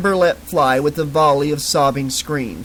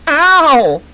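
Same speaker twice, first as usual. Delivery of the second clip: high sharp yelp of pain; pained squeal; 12 semitones higher, 9 dB louder than usual. Acted. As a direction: squeal like you've been pinched. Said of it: "Amber squealed".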